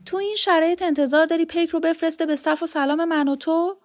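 scolding Lagha. Acted. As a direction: talk sense into him, insistent and exasperated. Lagha scolds Yavar in story.

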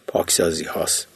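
half-whispered aside, barely voiced; muttered under breath. A narrator's reading. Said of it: "زیر لب گفت". (زیر لب و نجواگونه)

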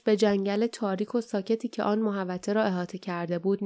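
usual speaking voice

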